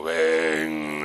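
a deep, otherworldly low call; navel's beckoning call. Pretend. The preacher voices the navel calling him home, deep and beckoning. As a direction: lure them home like the buried navel. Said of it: "el ombligo el que le dice a uno".

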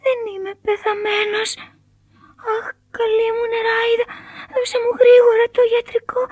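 high, crying puppet begging; tearful pleading. A narrator's reading. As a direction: beg the fairy through tears. (κλαψιάρικη ικεσία)

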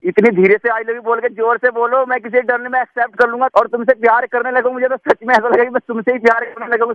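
high, eager begging to hear it again; desperate pleading. Acted. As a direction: plead like a lovestruck fool on the phone. Begs her to repeat I love you.

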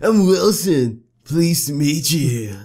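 Wilson. Acted. Vet introducing himself, slow put-on character voice. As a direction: draw out a polite introduction. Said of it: "You do him now".

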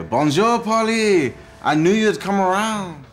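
loud, high bad French accent greeting; hammed-up French accent, calling out her name. Greeting Polly on speakerphone, in a loud, bad French accent. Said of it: "your French accent hasn't got any better".

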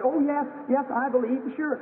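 put-on a girl grabbing onto faith, voice way up; eager sick girl. Dying girl answers the preacher, high and eager.

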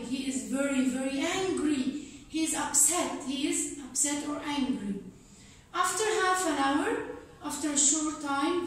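ordinary talk, nothing marked

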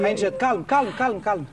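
low, soft, steadying voice; calming reassurance. Acted. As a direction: settle them down quietly. Soldier steadies the frightened group.

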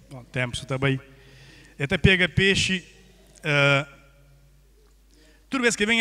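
ordinary delivery

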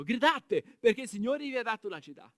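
comando forte, come un condottiero; ordine gridato. Acted. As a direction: grida l'ordine al popolo. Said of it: "Giosuè disse al popolo". (Giosuè ordina al popolo di gridare, a gran voce)

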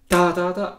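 first ta punched loud and high; accent on the first. Shown as a demonstration. Teacher shows accent on the first note. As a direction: hit the first ta hard. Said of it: "with the accent on the first".

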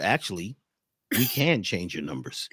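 low, sober expert voice; imitated seriousness. Retelling the numerologist answering a joke, mock serious. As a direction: drop way down, as if suddenly all business. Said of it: "he got really serious and said".

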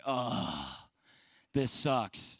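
a low groaning ugh into deflated words; mock dejected ugh. Youth speaker retelling his pastor leaving, mock-sad groan. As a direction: sigh it out, mildly hammed-up.

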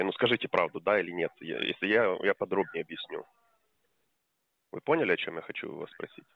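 ordinary delivery

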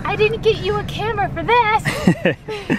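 high, slow scolding mom voice; exasperated mother, drawn out slowly. Retold or imitated. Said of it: "my mom would be like".

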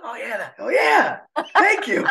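high startled oh yeah, then thanks; sudden realisation. Retold or imitated. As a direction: catch yourself, embarrassed. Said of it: "I'm like".

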